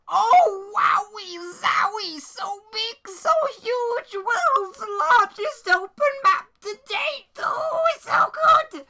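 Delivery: high, hammed-up gushing rising to a shout; sarcastic squealing amazement; mock awe. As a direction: gush like an overexcited fanboy. Mock awe at small map.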